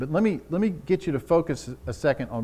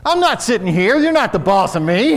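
defiant retort; 9 semitones higher, 10 dB louder than usual. Pretend. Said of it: "respond to that guy like".

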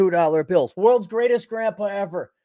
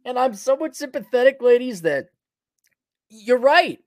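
sarcastic sympathy; 7 semitones higher, 4 dB louder than usual. Acted.

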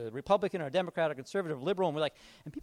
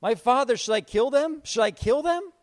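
loud eager shout, raised pitch; king shouting for permission; 5 semitones higher, 7 dB louder than usual. Retold or imitated. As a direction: shout it at the prophet, eager to kill. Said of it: "he shouted to Elijah".

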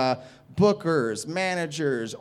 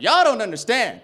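higher, loud shouted comeback; defiant outburst; 9 semitones higher, 6 dB louder than usual. Retold or imitated. Comedian tells off a teenage crowd, defiant shout. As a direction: blow up at the crowd.

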